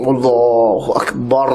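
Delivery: mock over-long imam's takbir; straining imam. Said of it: "เป็นอิหม่ามแล้วก็เข่ง".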